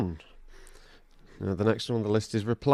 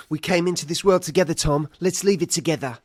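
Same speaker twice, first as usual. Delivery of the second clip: dramatic film-hero line, pitched up; Harry Potter; 7 semitones higher than usual. Acted. Hero grabbing his enemy before the jump, dramatic imitation. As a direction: play the hero's big moment, higher voice. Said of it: "He grabs Voldemort and he goes".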